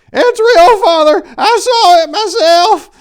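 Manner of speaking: put-on very high, loud old-lady falsetto; old lady Pickles. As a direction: squeal it out like a frantic old woman. Old lady insists, frantic.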